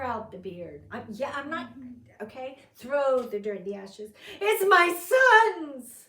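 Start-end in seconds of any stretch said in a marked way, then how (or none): 4.4-6.0 much higher and louder, slow, crying out; anguished wailing cry. Retold or imitated. Jacob recognises his son's bloody robe, wailing shout. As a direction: wail it like a father who just lost his son. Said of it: "So he's wailing. It's loud".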